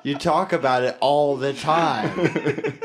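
loud, slow, drawn-out complaint; exasperated friend. Acted. Friend fed up with the drunk spy's bragging, loudly exasperated. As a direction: drag it out, fed up.

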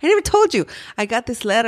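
high, bright rush of news; excited friend. Retold or imitated. Friend calling, excited about the surprise letter, high and eager. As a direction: gush it out like big news. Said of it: "she called me and she was like".

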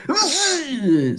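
high, sneeze-like grunt; sneezing grunt. Retold or imitated. Imitating the Forlons' weird sneeze-like cry. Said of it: "Sounds like a guy kinda sneezing".

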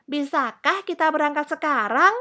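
put-on high, loud child's question; eager little princess. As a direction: ask like an impatient little girl. Eager princess asks parents to go.

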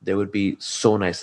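ordinary talk, nothing marked

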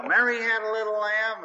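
playful quiz, up and loud; grandpa quizzing kids. Retold or imitated.

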